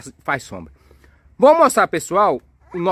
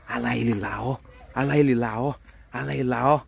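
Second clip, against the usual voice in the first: low, hushed pointing-out; stealthy lookout; 9 semitones lower, 6 dB quieter, faster than usual. Pretend. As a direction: keep it down as if spying on a monster. Spying on dinosaur while hiding. (voz baixa, espiando escondido)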